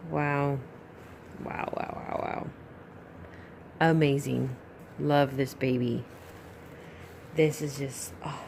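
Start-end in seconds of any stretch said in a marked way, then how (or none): none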